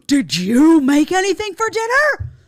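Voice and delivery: shrieked back high, loud, slow and appalled; outraged repeat. Retold or imitated. Host mocking women's outrage at a dinner question: shrieking, appalled. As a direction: repeat it as if it were an insult. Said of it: "that type of reaction".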